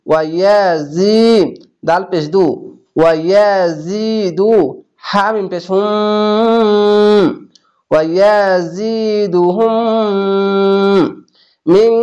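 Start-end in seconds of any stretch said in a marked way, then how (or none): 5.1-11.9 drawn-out chanted reading; slow tajweed chant; slow recitation. Shown as a demonstration. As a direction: intone each syllable slowly. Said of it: "spelled then recited".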